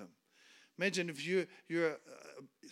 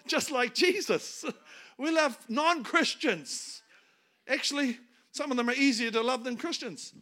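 preaching at full force; 5 semitones higher, 8 dB louder than usual. Acted. Preacher urges the congregation to love sinners, loud fervent preaching. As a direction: drive it home from the pulpit.

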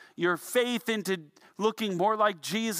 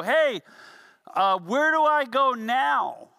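called-out question, higher and louder, slow; yelling down for help; 5 semitones higher, 5 dB louder, slower than usual. Retold or imitated. Stuck climber yells down to friends below, louder and higher. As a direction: shout the question down to friends on the ground. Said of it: "You'd yell over your shoulder".

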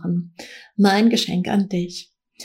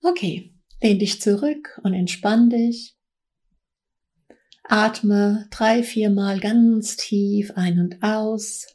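right in your ear. Acted. Meditation opening, close to the mic.

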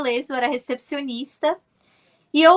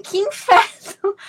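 drawn-out, exasperated, higher and louder; exasperated groan; 7 semitones higher, 5 dB louder, slower than usual. Retold or imitated. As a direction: moan it like everything's going wrong. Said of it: "Eu falo assim". (resmungo exasperado, mais agudo e lento)

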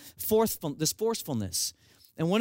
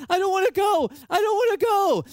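much higher, louder imitated child's scream; screaming kids; 13 semitones higher, 4 dB louder than usual. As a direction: wail it like a terrified kid refusing the ride. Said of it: "kids are screaming now and crying".

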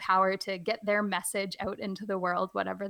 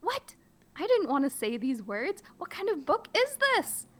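much higher, squeaky disbelief; mock outrage; 8 semitones higher than usual. Teacher shocked by the book, squeaky mock outrage. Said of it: "I'm really trying to play it up".

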